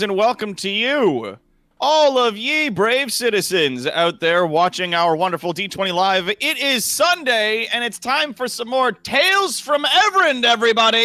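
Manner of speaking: big hyped show opener; announcer voice. Acted. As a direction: boom it out like a host. Stream host's hyped opening.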